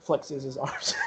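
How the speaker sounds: ordinary talk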